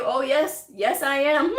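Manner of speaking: playful, high-pitched vouching; emphatic yes. Acted. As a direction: answer proudly for the provider, breaking into laughter. Acting out providers' emphatic confident answer.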